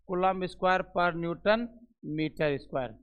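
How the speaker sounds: unit read very slowly; teacher dictating the unit; slow dictation. Shown as a demonstration. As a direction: dictate it word by word. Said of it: "इसको याद रखिएगा".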